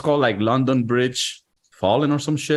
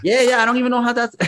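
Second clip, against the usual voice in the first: higher put-on mocking voice; fast, high mock take of his own line; a teasing high-voiced redo; repeat in a put-on voice; 9 semitones higher, faster than usual. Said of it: "Oh, hell nah".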